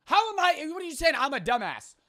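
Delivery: high, loud disbelief; high, exasperated yelling; indignant outburst. Real, not acted. Indignant outburst at surprising answer.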